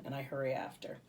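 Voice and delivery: plain reading voice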